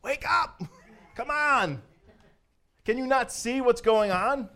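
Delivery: mock-exasperated shake-them-awake plea; high-pitched cry to oblivious scoffers; exasperated wake-up call. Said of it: "and just be like".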